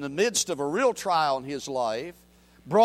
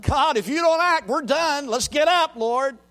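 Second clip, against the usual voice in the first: much higher, louder desperate cry; David's urgent plea; 9 semitones higher, 7 dB louder than usual. Retold or imitated. David crying out to God in battle, pleading. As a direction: beg God to rise and act. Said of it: "And so David says to God".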